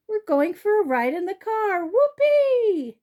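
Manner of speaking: a dog who can't contain his delight; joyful whoop. A narrator's reading. Dog realizing a car ride, joyful whoop.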